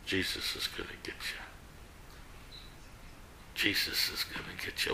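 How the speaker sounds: hushed, whispered parrot voice; eerie whisper from the parrot; whispered warning. Acted. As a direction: whisper it like an unseen voice in a dark house. Parrot's eerie warning to burglar in joke.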